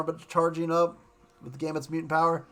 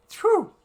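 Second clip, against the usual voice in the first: big exhale; 7 dB louder than usual. Retold or imitated. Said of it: "I was like".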